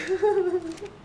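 high-pitched giggling fit; helpless high cackle; high laugh. Real, not acted. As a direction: burst out laughing. Amused after Giga kill.

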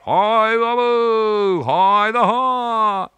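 very high, loud shouted call; boy showing the policeman how to call like on the rafts; raftsman's call. Shown as a demonstration. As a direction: hurl the river cry out full force. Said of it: "uite așa".

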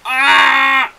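loud pained "aah" at a fall; frustrated yell. Real, not acted. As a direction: yell out as the character drops. Gamer falls, yells in frustration.

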